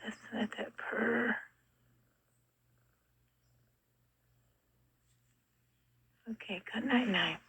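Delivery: soft hushed good-night whisper. Real, not acted. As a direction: whisper so the kitten sleeps. Whispering beside kitten.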